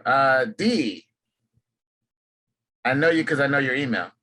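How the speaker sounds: ordinary talk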